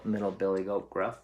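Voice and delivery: usual speaking voice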